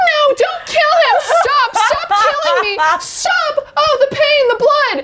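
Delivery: high, wailing cries of a dying neighbour; hammed-up murder victim. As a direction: overact being killed next door, crying out. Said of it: "you were just like".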